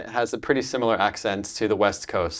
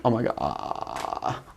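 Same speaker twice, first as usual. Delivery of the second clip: slow, low creaky Californian fry; vocal fry; 7 semitones lower, 7 dB quieter, much slower than usual. Shown as a demonstration. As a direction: let the voice drop into a long creak. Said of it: "like, vocal fry".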